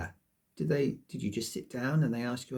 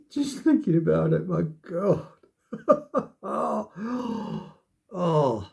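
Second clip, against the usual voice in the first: louder, higher, laughing it off; nervous laughter; 5 semitones higher, 7 dB louder than usual. Real, not acted. Twitching at the thought of the assessment, laughing nervously. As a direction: giggle through the nerves.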